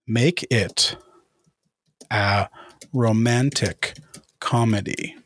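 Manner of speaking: slow dictation with long pauses; dictating while typing. Real, not acted. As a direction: spell out the prompt piece by piece, slowly.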